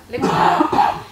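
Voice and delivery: word breaks into a laugh. Real, not acted.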